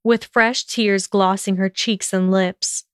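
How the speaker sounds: ordinary delivery, nothing marked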